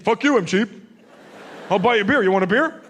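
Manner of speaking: loud, brash beer offer; loud defensive offer. Retold or imitated.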